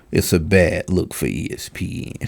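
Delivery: low, softened parody of gravity; mock sullen. Commentator mimicking the athlete's verdict on the network, low mock-grave voice. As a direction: imitate his solemn verdict well below your own pitch. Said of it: "just talking through his teeth".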